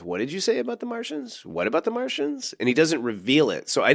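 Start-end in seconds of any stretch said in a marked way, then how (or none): 0.0-2.5 quoting Inaros. Retold or imitated. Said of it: "he goes".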